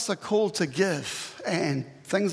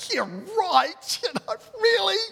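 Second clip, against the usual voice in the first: high, laughing disbelief; scoffing laugh; 13 semitones higher than usual. Acted. Disciples scoffing at Jesus's request with high laughing disbelief. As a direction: laugh it off as absurd.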